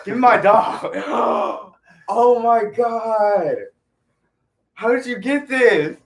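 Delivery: can't believe the gift; overwhelmed surprise. Real, not acted. [name] gets his dream dog, stunned high surprise.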